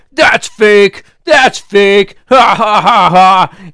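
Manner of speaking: loud scoffing mock voice. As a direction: sneer like a know-it-all denier. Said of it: "they're like".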